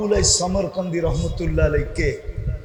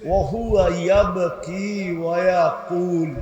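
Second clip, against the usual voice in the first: normal talking voice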